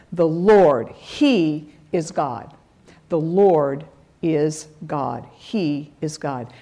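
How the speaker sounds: slow, low, reverent declaration; awed worship. Acted. The people falling down before God, low and slow. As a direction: say it in awe, face down.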